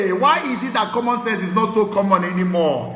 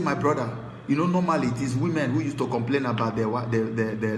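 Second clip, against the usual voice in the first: quieter, hushed voice of a troubled man; confiding complaint; 10 semitones lower, 7 dB quieter than usual. Retold or imitated. A husband quietly confides his wife's unfaithfulness, hushed. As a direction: lower and softer, as if admitting something shameful.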